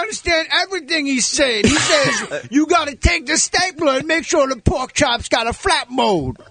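high, whooping comedian impersonation; exaggerated high comic character voice; Tracy Morgan. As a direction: pitch it way up, stretched and sing-song. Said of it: "thank you, Tracy".

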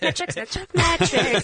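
laughing, slow, savouring the new word; mock showing off. Proud of the new word metrics, teasing laughter. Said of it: "you sound so smart right now".

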